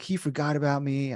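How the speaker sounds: ordinary delivery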